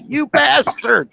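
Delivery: high, slow hammed-up yell; mock yell. Retelling John Candy water skiing, slow high mock yell. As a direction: drag it out high like he's hanging on for dear life. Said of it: "He goes".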